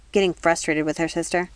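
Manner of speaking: plain reading voice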